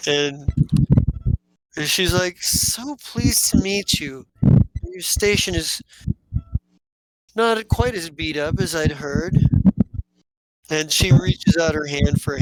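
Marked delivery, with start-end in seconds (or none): 2.4-9.4 lightly voiced sly sister, slow and higher; a hint of a catty sister; sly greeting. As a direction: greet them with a honeyed dig.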